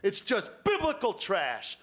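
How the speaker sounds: hammed-up scornful outcry, no real volume; mock scream. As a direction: imitate a screaming inner voice, drawn out. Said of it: "a voice screams at me".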